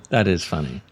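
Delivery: ordinary delivery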